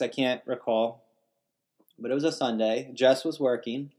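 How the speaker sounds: ordinary delivery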